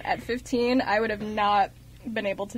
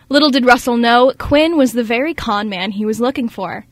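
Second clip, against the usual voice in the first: steady reading aloud; synopsis read; 10 dB louder than usual. Acted.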